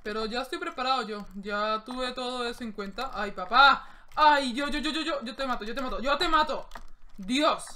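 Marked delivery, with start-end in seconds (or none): 3.1-7.6 loud, high gamer outburst at the screen; threatening the enemy, loud and excited; excited yelling. Real, not acted. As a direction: yell in the heat of the fight. Gamer shouting mid-fight.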